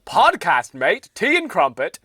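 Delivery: loud, slow hammed-up British; put-on British voice. Host claiming to be a little British, mock British accent. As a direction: lay on a cartoon British accent, loudly. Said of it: "Why are you doing that voice?".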